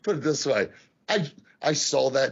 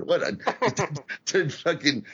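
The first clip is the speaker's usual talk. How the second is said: ordinary talk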